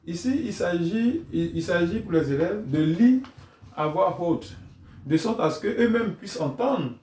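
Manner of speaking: ordinary delivery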